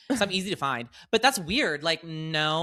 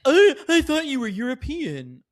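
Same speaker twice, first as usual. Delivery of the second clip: high, loud mocking voice; exaggerated, silly put-on voice; mocking imitation; 11 semitones higher, 7 dB louder than usual. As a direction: mimic a clueless pick-up line. Mocks the man's pick-up line.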